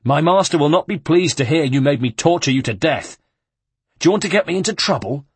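loud mocking guard, the question rushed fast; a bully sneering at his victim; taunting guard. The guard mocks the tortured monk, loud and scornful.

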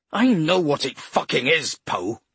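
loud angry snarl. A narrator's reading. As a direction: spit it out furiously. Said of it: "he snarled".

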